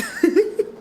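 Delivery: high real laugh bursting out; a sudden high giggle fit; burst of laughter. Real, not acted. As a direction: crack up at the joke. Laughing at her own imitation.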